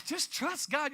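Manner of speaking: high, loud preachy line; hammed-up pastor telling you to trust; preacher's advice. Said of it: "somebody standing up front of you like me going".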